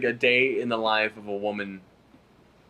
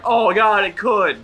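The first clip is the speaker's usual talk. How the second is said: much higher and louder gasp of dread; mock horrified viewer; 10 semitones higher, 7 dB louder, slower than usual. Viewer reacting to the ad, hammed-up horrified cry. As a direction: play it as overblown shock.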